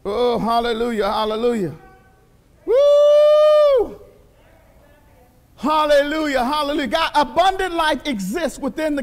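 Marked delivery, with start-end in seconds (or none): 2.6-4.0 high, loud preacher's whoop; exultant woo. Real, not acted. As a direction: let out a joyful shout.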